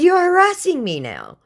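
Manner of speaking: sharp rebuke. Retold or imitated. She calls out harassment.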